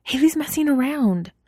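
put-on embarrassed girl cutting in urgently. A narrator's reading. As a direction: hurry to stop her friend. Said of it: "Wei Wei said urgently".

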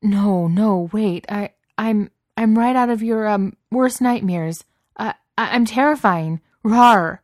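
high, loud, stammering bluster; flustered desperation. A narrator's reading. Beast failing to scare Cook off, flustered desperation. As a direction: insist frantically that you are scary.

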